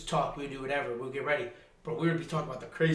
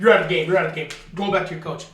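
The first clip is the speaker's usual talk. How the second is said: loud, retold referee command; imitated ejection order; 8 dB louder, faster than usual. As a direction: send a player off, lightly acted. Acts out ejection from the game.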